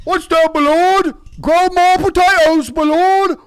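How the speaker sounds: Warcraft ogre voice. Acted. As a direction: ham up a game peon answering his lord. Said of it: "that's my voice of the, of a Warcraft two, uh, ogre".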